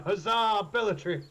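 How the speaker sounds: high, hearty battle cheer; in-character shout. Acted. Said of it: "I will just shout".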